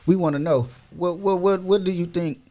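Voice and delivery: ordinary talk, nothing marked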